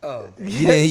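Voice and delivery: normal talking voice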